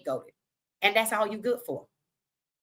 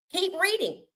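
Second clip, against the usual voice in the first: "Keep reading" pitched higher, pressing the point; urging viewers to read past the headlines, pitched higher; higher insistent repeat; 9 semitones higher than usual. Real, not acted.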